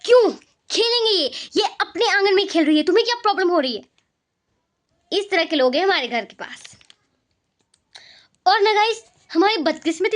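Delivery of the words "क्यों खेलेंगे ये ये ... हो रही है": higher, defensive mother voice, rushing at the end; neighbour's mother defending her kids, higher and fast. Retold or imitated. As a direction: mimic the neighbour lady snapping back. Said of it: "उनकी mummy क्या कहती थी".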